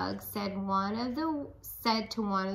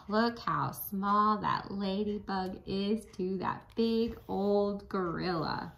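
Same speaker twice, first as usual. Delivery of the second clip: plain reading voice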